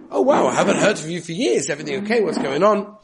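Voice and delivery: high, loud, laughing greeting; pleased surprise. Retold or imitated. Principal surprised to hear from the fired teacher, delighted. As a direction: greet an old contact with delight. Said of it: "the principal answered".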